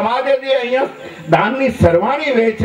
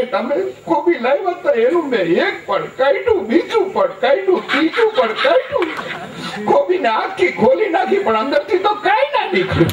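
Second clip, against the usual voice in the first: higher, laughing mock wife complaining; wife's voice; 7 semitones higher than usual. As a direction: play the puzzled wife, giggling through it. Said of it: "મને કે". (હસતાં હસતાં પત્નીનો ઊંચો અવાજ)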